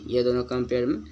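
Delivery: ordinary talk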